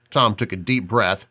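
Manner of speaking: ordinary delivery, nothing marked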